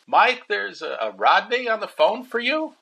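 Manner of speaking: high voice of mom calling down the stairs. Retold or imitated. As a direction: call up high like a mother summoning her son. Mom calls him to phone.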